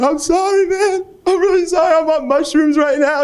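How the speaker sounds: a tripping guy sobbing sorry; hammed-up crying on mushrooms; mock crying apology. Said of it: "He started crying".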